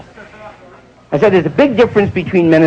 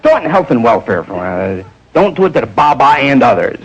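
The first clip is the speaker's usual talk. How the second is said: usual speaking voice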